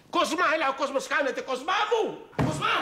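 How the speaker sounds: ψηλά, δυνατά, σε πανικό; φωνάζει τον Κοσμά να τρέξει; πανικόβλητες φωνές. Acted. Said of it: "τι φωνάζεις". (τρομαγμένες δυνατές φωνές)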